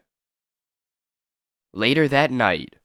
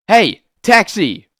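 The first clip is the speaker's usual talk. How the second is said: calling out for a taxi; 9 semitones higher, 8 dB louder, slower than usual. Acted. Hailing a taxi.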